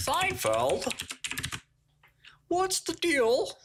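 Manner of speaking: high, stretched-out stand-up comic voice; exaggerated squeaky observational-comedy delivery, drawn out; Seinfeld. Riffing on old show references, mock Seinfeld.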